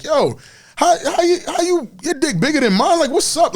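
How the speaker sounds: high-pitched, baffled protest; mock bafflement. Man protesting to a prideful girlfriend, baffled and high-pitched. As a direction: stammer it out high, in playful disbelief. Acts out his baffled reaction to her.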